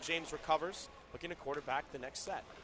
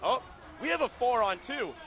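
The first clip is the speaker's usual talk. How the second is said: loud, high burst of excitement; excited announcement; 8 semitones higher, 8 dB louder than usual. Real, not acted. Commentator spotting a four on two, excited announcement. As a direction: announce the sudden advantage with a real lift. Realizing a big power play.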